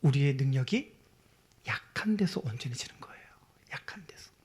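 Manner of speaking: low, intimate whisper. Acted. Preacher confiding the point to the congregation in a whisper. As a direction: breathe it to the listeners.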